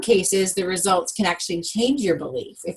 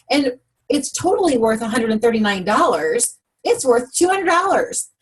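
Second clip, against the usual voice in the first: high-pitched gushing sales enthusiasm; thrilled pitch, voice lifting high; acted excitement; 5 semitones higher than usual. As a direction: gush as if you truly believe in the deal. Acting out an excited belief.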